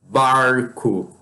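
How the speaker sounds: put-on "Barco" in a Portuguese accent with curled R; Portuguese retroflex-R accent. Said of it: "Como quem tem o sotaque".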